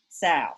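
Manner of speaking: sail said as sow with no L; sail without L. Shown as a demonstration. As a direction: let the L vanish: sow. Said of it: "I say sow".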